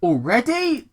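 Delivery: high, dumbfounded question; puzzled Wade. Acted. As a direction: ask it like a confused dumbass. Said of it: "Wade questioned".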